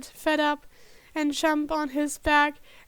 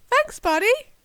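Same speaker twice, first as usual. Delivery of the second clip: grateful; 8 semitones higher, 4 dB louder than usual. Acted. Grateful to the dog.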